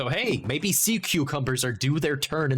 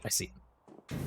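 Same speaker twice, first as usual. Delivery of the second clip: whisper; 11 dB quieter, slower than usual. Real, not acted. Spotting a hidden rib.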